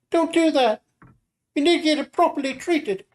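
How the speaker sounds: high-pitched Nami, hushed and curious; high girl voice. Acted. Nami asks her friend about a rustling noise, high girl voice.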